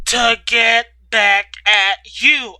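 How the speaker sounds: slow, loud yell. Acted. Ryder yelling at Katie in the closet. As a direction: yell it back at her, slowly. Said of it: "I yelled".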